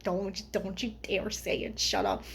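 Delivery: low, soft imitation of a friend; hushed warning. Friend hushing her in the movie theater, soft warning.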